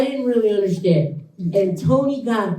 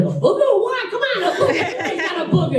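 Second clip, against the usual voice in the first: mocking Tony; 11 semitones higher than usual. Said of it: "And Tony's like".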